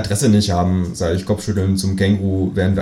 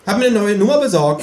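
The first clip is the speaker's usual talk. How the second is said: 1 smug kangaroo; 13 semitones higher, 3 dB louder than usual. A narrator's reading.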